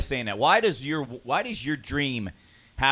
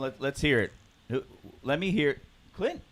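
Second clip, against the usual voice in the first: ordinary talk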